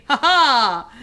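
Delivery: loud, high gloating cackle; triumphant laugh. Real, not acted. Triumphant laugh after boast.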